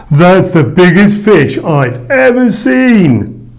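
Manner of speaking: high, loud, slow awestruck groan; awed groan. A narrator's reading. Jonah seeing the huge fish, groaning in awe. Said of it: "groaned Jonah".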